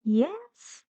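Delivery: "yes" swooping up like a question; teacher showing a mistaken rising "yes"; wrong rising intonation. Shown as a demonstration. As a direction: lift "yes" steeply at the end. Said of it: "Not yes".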